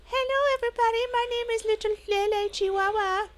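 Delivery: high squeaky pet voice, loud; dog's voice. Pretend. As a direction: speak for the little dog.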